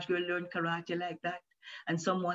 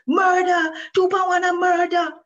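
high, loud crowd scream; 13 semitones higher, 11 dB louder than usual. A narrator's reading. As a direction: yell it over the crowd. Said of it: "the screams changed to".

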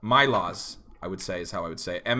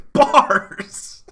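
hype shout; 5 semitones higher, 6 dB louder than usual. Real, not acted. Hyping up a rhyme.

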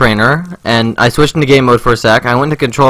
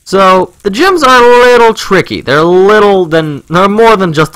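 playful hedging; 8 semitones higher, 3 dB louder than usual. Real, not acted. Hedging playfully about gym difficulty.

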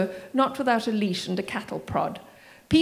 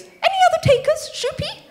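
squeaky falsetto panic, much higher and louder; voice up two octaves; 7 dB louder than usual. A narrator's reading. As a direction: shoot the voice way up in mock alarm. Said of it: "his voice going up two octaves".

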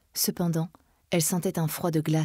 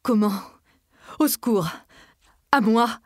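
higher, loud panicked shouting; cry for help; 5 semitones higher, 5 dB louder than usual. A narrator's reading. Charles calls the house for help, panicked shout.

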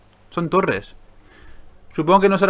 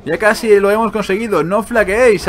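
raised, louder heroic encouragement; rallying commander; 7 semitones higher, 7 dB louder than usual. Acted. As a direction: urge the army not to give in. Reads battle encouragement from game.

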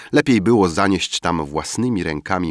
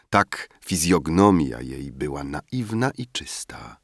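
ordinary delivery, nothing marked

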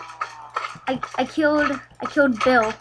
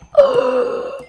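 sharp gasp; 11 semitones higher, 3 dB louder than usual. Real, not acted. Creeper blows up diamond.

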